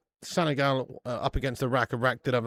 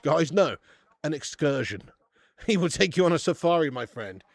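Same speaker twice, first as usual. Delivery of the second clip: normal talking voice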